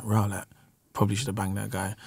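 low, muttered inner thought; low and muttered, brooding after the game. Retold or imitated. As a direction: think it aloud quietly to yourself. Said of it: "I'm at home thinking".

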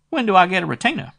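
a small-time dick squeaking out his price; shrill private eye. A narrator's reading. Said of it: "Then he asked".

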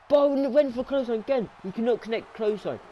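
high, loud burst of commentary; sudden high-pitched match call; exasperated play-by-play. Real, not acted. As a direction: exclaim at the repeated missed move. Reacting to a missed move.

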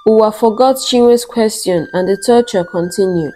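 ordinary delivery

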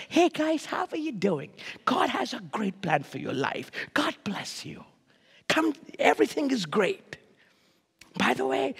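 soft, breathy, soothing preacher parody. Retold or imitated. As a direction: coo gently, near whisper, with mock sweetness.

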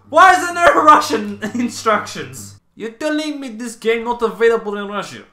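playful indignant put-on voice; mock Russian outrage. Vlogger pretends outrage no Russian manual, mock accent.

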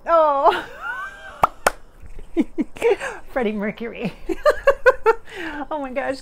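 loud, high burst of laughter; delighted laughing surprise. Real, not acted. Psychic recognizing [name]'s spirit, laughing loud and high. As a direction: shriek with joy at the arrival.

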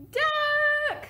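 high, loud panicked scream of a name. Acted. Farmer Brown on the phone hearing the animals, screaming. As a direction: shriek the name in alarm. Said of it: "screamed Farmer Brown".

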